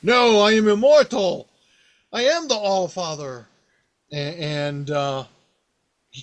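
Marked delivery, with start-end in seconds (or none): 0.0-3.5 higher, louder proud proclamation; god proclaiming. Retold or imitated. Allfather answering the mice, loud proud claim. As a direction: insist on immortality, ringing out. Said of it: "he's like".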